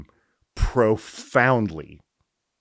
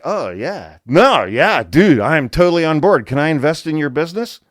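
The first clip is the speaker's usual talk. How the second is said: eager tech bro; 5 semitones higher, 5 dB louder than usual. Retold or imitated. Mimicking eager billionaires.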